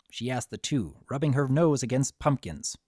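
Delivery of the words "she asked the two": ordinary delivery, nothing marked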